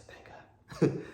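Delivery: unvoiced, under-the-breath thanks; muttered relief. Passenger hearing he goes home, whispered relief. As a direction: whisper it as a private aside.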